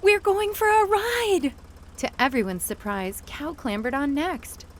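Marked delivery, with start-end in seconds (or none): 0.0-1.5 high, loud eager voice; a dog thrilled to ride; dog's thrilled thought; excited dog. A narrator's reading. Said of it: "what he thought was".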